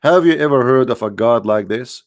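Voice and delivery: ordinary delivery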